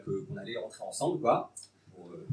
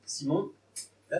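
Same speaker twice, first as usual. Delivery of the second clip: normal talking voice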